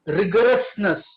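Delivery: "rigorousness" set apart and said firmly, with emphasis; stress on rigorousness. Real, not acted. Panelist drives home the key to tradition, stressing "rigorousness". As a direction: punch "rigorousness" as a word standing alone. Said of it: "I stress this word again and again".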